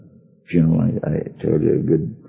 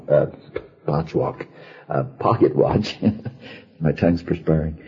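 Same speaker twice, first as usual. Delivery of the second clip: usual speaking voice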